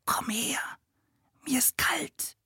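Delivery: soft unvoiced whisper. A narrator's reading. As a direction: breathe the words, barely audible. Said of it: "flüsterte Leo".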